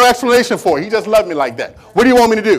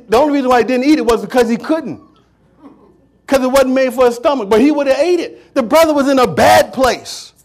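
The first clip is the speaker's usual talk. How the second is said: ordinary delivery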